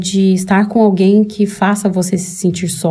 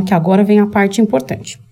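usual speaking voice

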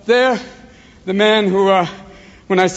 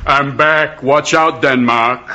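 low Austrian action-hero voice; Arnold Schwarzenegger; 6 semitones lower, much slower than usual. Acted. Action star walks into Hamlet's room, low Austrian-accented voice. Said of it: "Schwarzenegger walks into the room".

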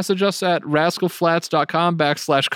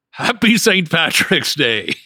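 put-on voice; 3 semitones higher, 4 dB louder, slower than usual. Silly put-on greeting.

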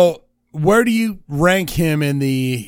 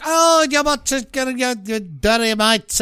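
lightly put-on English accent, pitched up; mock English accent; 6 semitones higher than usual. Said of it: "England is more like".

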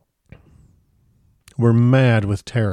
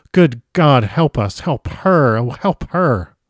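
louder pleading prayer, high on God; desperate plea; 3 semitones higher, 3 dB louder, slower than usual. A narrator's reading. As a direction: beg for help in despair. Harker begs God for help.

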